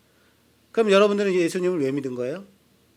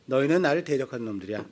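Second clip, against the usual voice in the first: low, soft, quick stern rebuke; God flatly repeating the charge; 4 semitones lower, 4 dB quieter, faster than usual. Acted. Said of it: "말라기에서 그러시는 거예요". (낮고 빠르게 되풀이하는 하나님의 책망)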